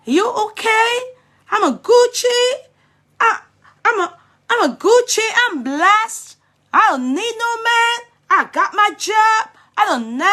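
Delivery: high, loud mocking imitation of a brash girl; mocking the girl's accent. Commentator mocking the girl's brag video, high mock voice. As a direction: mimic her bragging in a shrill-up put-on voice. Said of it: "wetin dey get into you people's head".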